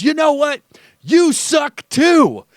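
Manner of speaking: mocking the coach's playground comeback; childish comeback. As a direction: sing it back like a sulky kid. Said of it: "he goes".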